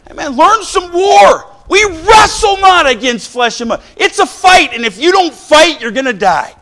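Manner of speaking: loud, high battle cry sermon; rally them to fight; preacher shouting a call to war; preaching shout. Acted. Preaching at full force.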